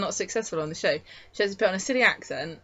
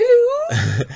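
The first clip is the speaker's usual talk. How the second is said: a much higher, louder, laughing mock voice; mock squeaky hello; 9 dB louder than usual. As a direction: squeal it out as a parody of her accent. Mocking the actress's put-on accent.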